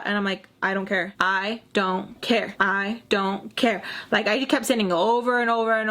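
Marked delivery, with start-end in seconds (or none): 1.2-3.8 half-speed, each word set apart; slow emphatic repetition. Retold or imitated. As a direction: space out "I... don't... care" deliberately. Same reply repeated for emphasis.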